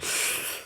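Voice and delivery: long noisy slurping intake of air; sucking up water. Acted. Cockerel sucking up all the well water, noisy suction. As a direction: imitate water being sucked up in one go. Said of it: "he opened his beak and".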